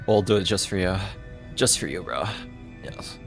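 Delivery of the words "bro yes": normal talking voice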